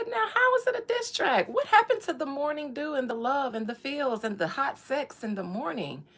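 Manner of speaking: high, baffled question; mock shock at the diss track; incredulous. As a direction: ask it in disbelief, way up high. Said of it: "And I said".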